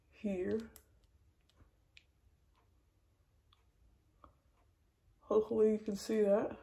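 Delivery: cheek pulled back with tool; speaking through stretched lips; talking with pick in mouth. Real, not acted. Seen 3: Dental pick held in mouth corner, pulling cheek back while speaking.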